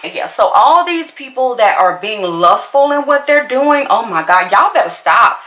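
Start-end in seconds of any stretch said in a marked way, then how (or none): none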